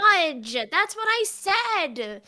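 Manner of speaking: loud, high protest, dragged out; indignant child character. Acted. Totoi protesting to a playmate, indignant. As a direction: insist on it like an offended little kid.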